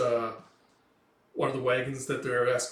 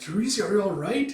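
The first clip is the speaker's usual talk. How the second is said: higher, louder anxious question; acted concern, voice raised; worried calling; 8 semitones higher, 5 dB louder than usual. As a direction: check urgently on someone hurt. Puck worried about injured girl.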